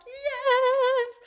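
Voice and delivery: a high, squealed yes of joy; ecstatic yes. Retold or imitated. Mum reliving hearing it's a girl, squealing with joy. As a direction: celebrate like you finally got your wish.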